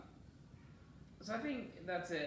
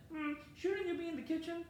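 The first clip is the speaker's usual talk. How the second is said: higher-pitched mock suspicious question; pitched-up, put-on doubtful voice; mock judgment; 11 semitones higher than usual. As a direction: lightly imitate a disapproving onlooker. Voicing the ladies' judging look.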